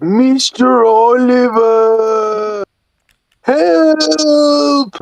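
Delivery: high, long-drawn wail for help; Toad. Acted. Toad calling Oliver for help, high drawn-out distressed voice. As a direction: stretch each cry out high and slow. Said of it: "That's my best Toad".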